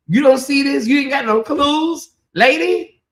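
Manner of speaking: high, incredulous mock scolding; exasperated disbelief. Mock questioning of the oblivious wife.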